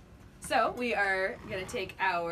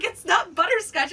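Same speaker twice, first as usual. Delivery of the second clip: higher, louder mock insistence; imitating the clerk; 8 semitones higher, 7 dB louder than usual. Mimicking the clerk insisting loudly that it's not butterscotch. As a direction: parrot him like a know-it-all. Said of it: "he was just like".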